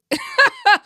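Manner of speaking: high incredulous burst of laughter; loud high cackle of disbelief. Real, not acted.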